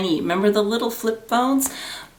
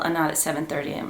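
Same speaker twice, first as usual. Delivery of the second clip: normal talking voice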